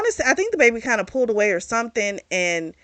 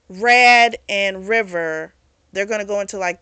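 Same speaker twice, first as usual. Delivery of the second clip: ordinary delivery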